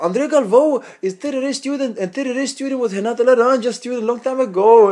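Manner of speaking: high, loud imitated Brazilian accent; Renato Laranja. Claiming rivals as his students, mock Brazilian accent. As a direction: reel it off as a Brazilian braggart. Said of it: "he talks with this Brazilian accent, really thick".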